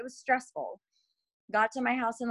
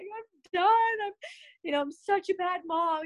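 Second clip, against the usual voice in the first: high, teary voice, a mild imitation of sobbing; retold crying; 10 semitones higher than usual. To her husband on the phone, imitating her own teary breakdown. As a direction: replay the tearful breakdown lightly. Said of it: "I was just crying".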